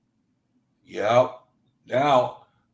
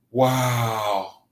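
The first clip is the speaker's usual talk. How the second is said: ordinary delivery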